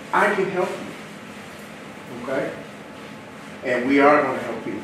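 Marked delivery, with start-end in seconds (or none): 0.1-2.5 softer, slow and tender; slow soft promise to the agents; soft reassurance. Shown as a demonstration. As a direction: say it gently and slowly. Repeats the promise, softer and slower.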